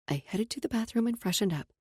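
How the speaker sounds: ordinary delivery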